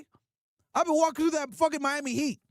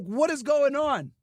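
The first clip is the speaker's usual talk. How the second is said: normal talking voice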